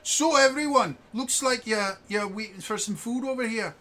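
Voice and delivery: high, loud Cockney call to the group; [name], Cockney accent. Acted. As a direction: announce it in high-pitched Cockney. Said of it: "it's a bloody Cockney accent".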